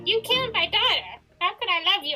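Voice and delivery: high, loud hammed-up anger; hammy heartbroken rage; mock fury. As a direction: throw the betrayal back at him. Henry rejects William.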